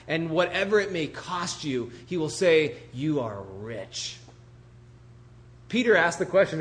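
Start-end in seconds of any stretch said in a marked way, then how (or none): 2.9-4.2 slow, low pronouncement; softened, reverent assurance; solemn reassurance. Acted. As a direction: draw it out gently as a promise. Quoting the Lord's promise.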